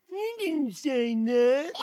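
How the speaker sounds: put-on slow, drawn-out childish protest; put-on sulky child voice; whining little bear. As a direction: whine back at your mother. Baby Bear protests to mother.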